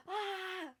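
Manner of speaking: short high "ah" of nerves; nervous squeal. Real, not acted. Poet shaking off nerves before reading, high squeal. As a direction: let out a high, nervous little cry.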